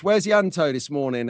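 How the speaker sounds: ordinary talk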